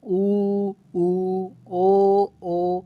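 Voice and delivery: ऊ and ओ held one by one, lips rounded; rounded vowels. Shown as a demonstration. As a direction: draw out each vowel through rounded lips. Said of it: "बन रहा है ना गोलाकार". (गोल होंठों से लंबा स्वर)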